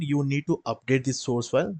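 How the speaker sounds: ordinary talk, nothing marked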